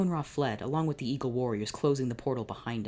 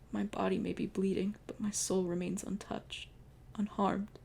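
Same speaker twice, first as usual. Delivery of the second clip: gentle dying whisper of love; tender reassurance; 6 semitones higher, 5 dB quieter than usual. Acted. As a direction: soothe him through the pain. Dying lover reassures pharaoh.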